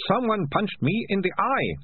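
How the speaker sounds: lightly voiced whining rat catcher. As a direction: whine about the punch.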